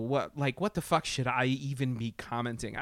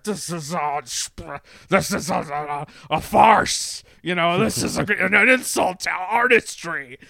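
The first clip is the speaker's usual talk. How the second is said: louder, rising to a higher indignant peak; mock pompous outrage; 4 semitones higher, 9 dB louder than usual. Mimicking Jim Carrey and Judd Apatow, in huffy artistic outrage. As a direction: parody a lofty artist aghast at the insult. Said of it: "And they were just like".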